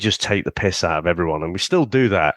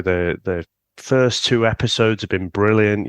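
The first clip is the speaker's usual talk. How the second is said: ordinary talk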